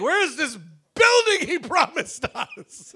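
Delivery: high, loud, slow laughing complaint; laughing outrage. Real, not acted. Pastor to congregation, laughing mock outrage.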